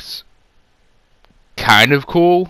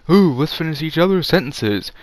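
higher, lightly voiced sing-song twins; mock twins; 7 semitones higher than usual. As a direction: parody the cutesy movie twins. Mocking movie twins.

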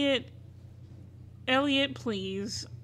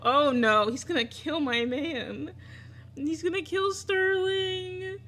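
louder, slow, high-pitched alarm; frightened cry at the game's turn; panicked; 5 semitones higher, 3 dB louder, slower than usual. Real, not acted. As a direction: wail that he'll kill her man. Fearing for her partner.

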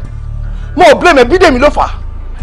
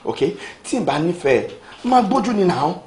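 much softer, lower, tender apology; soft pleading; 10 semitones lower, 11 dB quieter than usual. Acted. Femi softly begging Bidemi not to leave, tender. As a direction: beg her gently to calm down.

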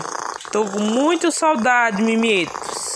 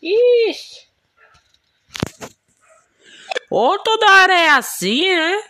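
exasperated cry; 10 semitones higher, 4 dB louder than usual. Real, not acted. Game annoyance.

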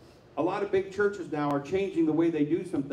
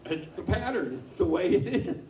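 usual speaking voice

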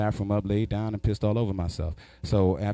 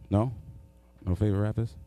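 ordinary talk

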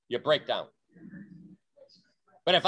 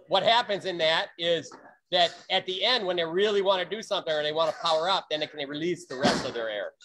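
usual speaking voice

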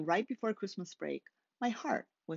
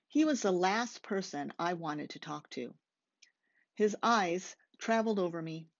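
usual speaking voice

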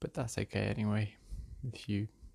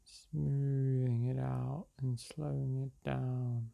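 unhurried meditation-guide voice with long pauses; guided meditation; slower than usual. Real, not acted. Meditation guide leading listeners through breath and release, calm and unhurried. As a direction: guide them gently through each breath, leaving space between phrases.

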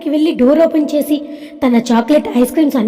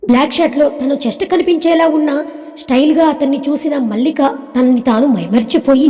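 ordinary delivery, nothing marked

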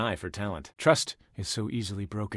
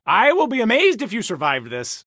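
high, loud gloating; gloating taunt; 10 semitones higher, 10 dB louder than usual. Acted. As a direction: crow over the beaten foe. Taunting foe in battle.

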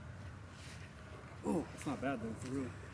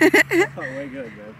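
playful mock boast; 11 semitones higher, 10 dB louder, slower than usual. Repeats friend's praise, playful.

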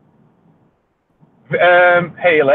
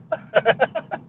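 high burst of laughter; real giggle; 10 semitones higher, 5 dB quieter than usual. Real, not acted. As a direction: laugh up high after the bit. Laughing after impression.

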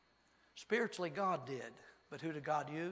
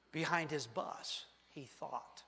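ordinary delivery

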